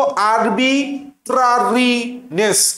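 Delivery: আরবি ট্রারি নেস in three slow chunks; split mnemonic pronunciation. Shown as a demonstration. Teacher breaking down a hard spelling, split mnemonic pronunciation. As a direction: say আরবি ট্রারি নেস as arbi, trari, ness, drawn out. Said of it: "তাহলে কিভাবে পড়বো?".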